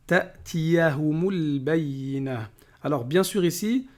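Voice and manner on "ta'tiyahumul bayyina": ta'tiyahumul bayyina slow, joined as one; linked words. Shown as a demonstration. Teacher showing the liaison, slow and joined. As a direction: glide the mim into al-bayyina. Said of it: "On fait la liaison ici".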